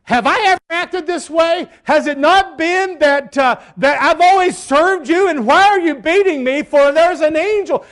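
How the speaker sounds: high-pitched, pleading donkey argument; protesting donkey. Acted. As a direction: plead your case as the wronged donkey. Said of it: "this donkey has a conversation with Balaam".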